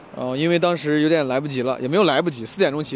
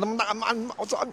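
mock swearing outburst; 7 semitones higher, 7 dB quieter than usual. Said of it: "我凶的时候可凶了".

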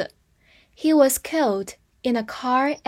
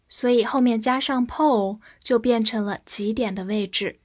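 usual speaking voice

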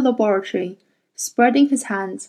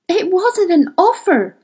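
hotly; 8 semitones higher than usual. Acted. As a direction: bite out each word hotly. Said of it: "she said hotly".

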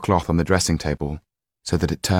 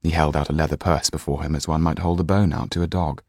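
ordinary delivery, nothing marked

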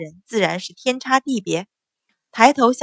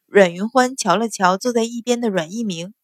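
ordinary delivery, nothing marked

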